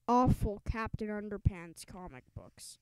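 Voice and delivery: usual speaking voice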